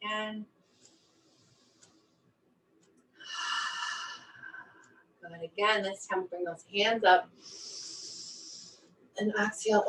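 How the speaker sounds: soft, calm breath-work voice; guided breathing. Acted. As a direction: ease them down with each breath. Leads calming breath work.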